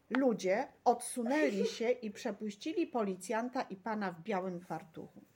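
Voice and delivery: usual speaking voice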